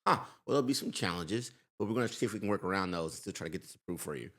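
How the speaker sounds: a hint of polite professional banker; lightly voiced banker. Banker softening bad loan news for a customer, lightly voiced professional. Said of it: "You think your banker would go".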